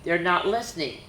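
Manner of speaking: put-on bouncy rhyming read-aloud for kids; lively story reading. A narrator's reading. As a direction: read it like a picture book to children. Reads a rhyming reindeer story.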